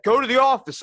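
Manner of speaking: high, loud imitation of an exasperated teacher; stern order. Teacher sends student to principal, stern mock shout. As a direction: act out a shouted dismissal.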